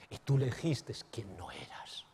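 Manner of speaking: accusing whisper. Acted. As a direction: hiss the guilt into his ear. La conciencia acusa a Jacob. (la conciencia susurrando el reproche)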